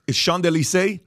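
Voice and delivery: Champs-Élysées said the French way, nasal vowel and no final s; French pronunciation. Real, not acted. Host naming a Paris street, said in French style. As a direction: give Champs-Élysées its real French sounds. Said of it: "shout out to your French".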